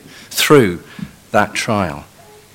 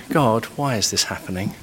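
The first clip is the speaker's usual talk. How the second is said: quiet sincere plea; 8 dB quieter than usual. Shown as a demonstration. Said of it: "one is asking for wisdom from God".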